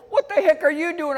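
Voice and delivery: high, loud mock outrage; indignant retort. Narrator's retort in acted quarrel.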